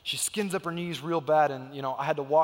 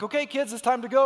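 higher, louder parent call; calling the kids; 6 semitones higher, 5 dB louder than usual. Retold or imitated. Dad calling his kids at the friend's house, mild mock call. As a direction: call out to the kids, time to leave.

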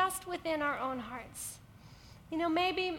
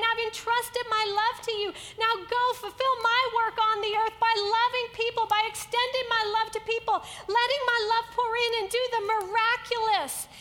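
commissioning God; 4 semitones higher, 6 dB louder than usual. Acted.

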